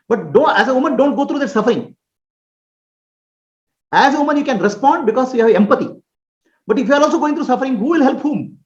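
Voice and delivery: normal talking voice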